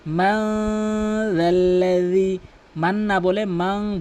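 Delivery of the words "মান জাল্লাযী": মান জাল্লাযী with ং and a clear য, slow; correct pronunciation. Shown as a demonstration. Teacher corrects the common mistake, slow recitation. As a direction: draw out জাল্লাযী with a clear z sound. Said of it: "বলতে হবে".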